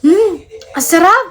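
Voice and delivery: high mock "mmm, so tasty"; playful high-pitched food moan; friends savoring food. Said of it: "sabi niya".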